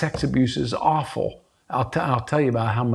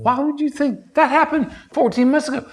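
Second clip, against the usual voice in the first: incredulous scoff climbing much higher; acted dismissiveness; 10 semitones higher than usual. What people say to a trauma sufferer, dismissive. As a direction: act out brushing off someone's trauma, pitch rising.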